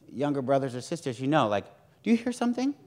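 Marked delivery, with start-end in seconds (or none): 2.0-2.8 high, teasing "do you hear something"; mock innocent. Older sibling teasing a younger one, mock innocent. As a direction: play dumb to a pesky sibling, up high.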